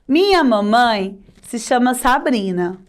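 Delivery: ordinary delivery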